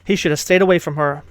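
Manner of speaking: ordinary delivery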